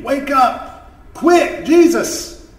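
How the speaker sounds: high, loud, urgent cry; storyteller's frantic scream; screamed plea. A narrator's reading. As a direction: shout to wake someone in a panic. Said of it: "they screamed".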